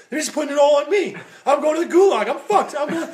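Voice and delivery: high, loud hammed-up panic; mock panic. Storyteller voicing Bert fearing the gulag, mock panicked. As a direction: wail it like a doomed man, half laughing.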